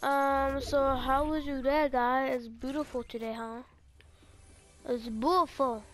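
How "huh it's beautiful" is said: normal talking voice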